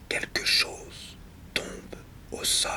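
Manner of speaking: hushed whisper, long gaps between phrases. Acted. Speaker noting something falling in the night, whispered. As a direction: whisper it haltingly, leaving silences.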